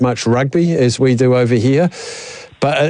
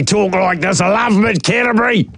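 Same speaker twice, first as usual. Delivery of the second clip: put-on rural Kiwi accent; 7 semitones higher than usual. Host mimics a caller in a broad Kiwi accent. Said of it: "Mad Mike sounds like he'd talk like this".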